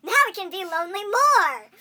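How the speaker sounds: high, loud exaggerated enthusiasm; hammed-up cheery proclamation; mock-upbeat imitation. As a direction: mimic the tone as if it were great news. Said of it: "he made it sound like it was something better".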